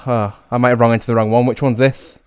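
ordinary talk, nothing marked

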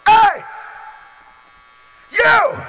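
sudden high shout across the room; booming "Hey, you!"; calling out. Retold or imitated. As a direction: call him out from the crowd like Jesus. Jesus calls out a man.

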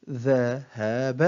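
very slow, each syllable drawn out separately; broken into syllables. Shown as a demonstration. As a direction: sound out the word syllable by syllable. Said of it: "تقطع الكلمة إلى حروف". (تقطيع الكلمة ببطء شديد مقطعًا مقطعًا)

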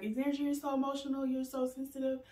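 lightly mocking critics' voice. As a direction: mimic people calling her oversensitive. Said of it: "people be like".

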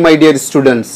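ordinary talk, nothing marked

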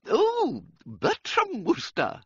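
high, slow gushing schoolmistress; eager headmistress. Acted. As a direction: play a delighted, decisive headmistress. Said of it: "said Miss Tomlinson decidedly".

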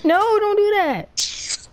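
slow, high whiny plea; a slow high whine; mock pleading. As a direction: drag it out high and begging. Pleading with teammate.

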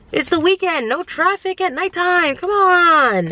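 high-pitched, protesting whine; exasperated. Real, not acted. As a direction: complain in disbelief, pitched well up. Exasperated complaint about Sunday traffic.

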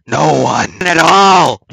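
full shout; bickering voices in one. Pretend. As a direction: yell it at yourself. Arguing with himself.

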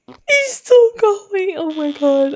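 high, slow tearful wail easing down; crying with laughter. Real, not acted. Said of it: "I'm literally crying".

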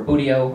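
buteo said "BOO-dee-oh"; variant pronunciation. Shown as a demonstration. Lecturer offering audience pronunciation options, variant pronunciation of buteo as "BOO-dee-oh". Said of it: "You say tomato".

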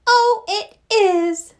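high, slow little mouse voice. A narrator's reading. Mouse agreeing with the other animals, high little voice. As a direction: pitch it way up and draw it out like a mouse. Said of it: "said the little mouse".

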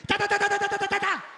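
loud high rat-a-tat machine gun sound; spray imitated bullets; gunfire noises. Mother gunning down her kids in the game, loud gunfire noises.

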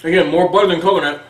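talking between bites; chewing cookie. Real, not acted. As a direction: mouth partly full. Seen 2: Holds cookie by mouth, bites it at 1.1, then appears to chew.

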